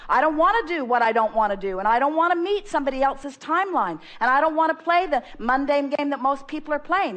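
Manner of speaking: mean every word of it; with emphasis. Shown as a demonstration. Speaker urging the guest to state wants emphatically, with emphasis. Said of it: "it doesn't hurt to put some emphasis in it by saying".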